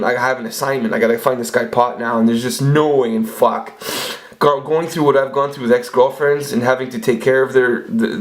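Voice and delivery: ordinary talk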